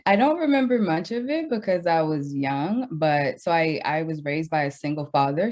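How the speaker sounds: normal talking voice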